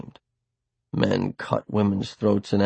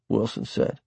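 usual speaking voice

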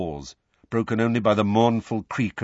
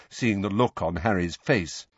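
plain reading voice

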